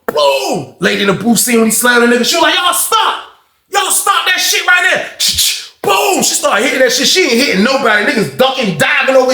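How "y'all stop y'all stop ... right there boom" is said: high, slow, shouted order to stop; commanding shout. Retold or imitated. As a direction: bark it like a guard breaking up a fight. Booth lady ordering fight stop.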